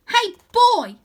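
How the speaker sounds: put-on little bully girl shouting; tough girl shout. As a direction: yell to grab his attention.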